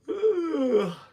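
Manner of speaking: loud sigh. Real, not acted. Loud sigh alone on line.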